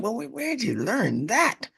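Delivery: high, exasperated mock questioning; hammed-up baffled challenge. Elder asking the youth where he learned to cuss, high mock voice.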